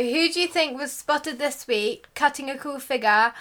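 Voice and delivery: ordinary delivery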